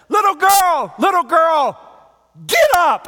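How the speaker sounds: very high, loud call to rise; Jesus shouting to the dead girl; shouted command. Acted. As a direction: shout her awake. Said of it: "And Jesus says".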